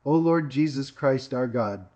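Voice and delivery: ordinary delivery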